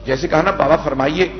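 measured, mournful majlis recitation; masaib recitation. Acted. Reciter telling the Kufa story to mourners, mournful. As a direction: chant the tragedy to the mourners.

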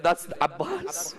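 slow, breaking into a sob; choked up. Real, not acted. [name] points out Abbas's head, choked up. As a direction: drag out the name through tears.

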